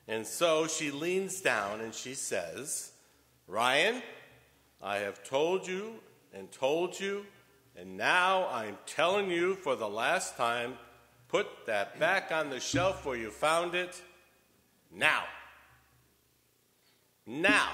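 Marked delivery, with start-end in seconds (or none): 3.5-4.0 put-on sharp, louder call of a child's name; fed-up mother calling out Ryan; stern warning. Mother snapping at misbehaving son. 4.8-17.7 louder, mock-stern scolding building to the final now; retold mother's last warning to her son; fed-up mother.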